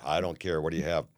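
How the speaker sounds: a lightly voiced dull barman brushing you off; boring bartender. Bartender NyQuil brushes off a chatty guest, lightly bored. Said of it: "so goddamn boring".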